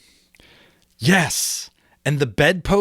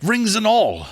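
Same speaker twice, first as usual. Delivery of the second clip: higher, louder giddy relief; Scrooge crying out; 7 semitones higher, 3 dB louder than usual. Acted. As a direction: cry out in disbelieving joy. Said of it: "cried Scrooge".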